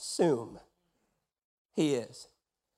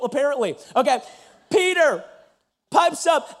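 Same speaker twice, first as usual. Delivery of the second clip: usual speaking voice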